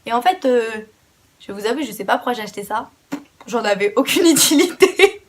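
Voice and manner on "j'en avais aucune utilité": real laugh breaking into the words. Real, not acted. Vlogger admitting a useless Primark buy to viewers, laughing. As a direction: laugh while confessing it.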